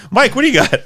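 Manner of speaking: high laughing question. Real, not acted. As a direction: ask while still laughing. Laughing hands over.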